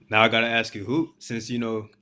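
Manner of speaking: normal talking voice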